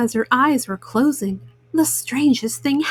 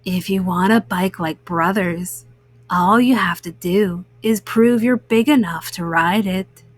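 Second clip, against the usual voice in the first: lower, eerie monster voice; low, coaxing dream monster; green-eyed monster; 6 semitones lower than usual. A narrator's reading. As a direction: beckon her like a tempting little monster. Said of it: "said the green-eyed monster".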